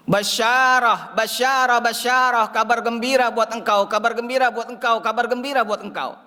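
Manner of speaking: high, insistent announcing of good news; acted excited herald, pitched high; joyful proclamation. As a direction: proclaim glad tidings, repeating with urgency.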